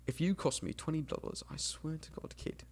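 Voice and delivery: hushed warning from an imitated mum. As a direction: threaten the kid under your breath. Mum threatens child over hospital cost.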